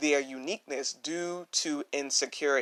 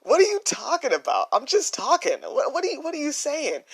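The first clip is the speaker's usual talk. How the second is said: much higher, fast puzzled questions; baffled retort; 10 semitones higher than usual. Retold or imitated. Young [name] protests to grandma, higher and faster. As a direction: keep pushing back, confused. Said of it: "I would look at her like".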